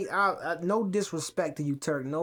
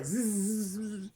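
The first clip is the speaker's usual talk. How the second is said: fly buzz; 6 dB quieter than usual. Retold or imitated.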